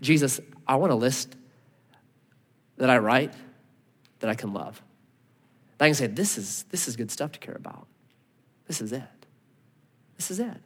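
soft, low, earnest plea; hushed repentant prayer to Jesus; quiet prayer. Retold or imitated. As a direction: pray it under your breath. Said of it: "I started praying and repenting".

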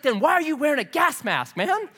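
high-pitched challenge, climbing at the end; mock incredulous. Roommate challenging the masked exterminator, incredulous. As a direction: push the question up high, imitating disbelief.

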